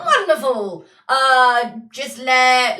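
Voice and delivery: loud, hearty pirate; hammed-up ship's captain; pirate sea captain. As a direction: boom it out like a sea dog. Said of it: "only way I know how to play sea people".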